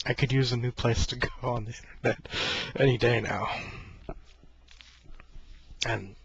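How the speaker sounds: ordinary talk, nothing marked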